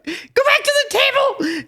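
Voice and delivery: high, loud, shouted command; urgent order. Retold or imitated. Wife ordering husband away from the buffet, high, loud shout. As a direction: send him back to the table now.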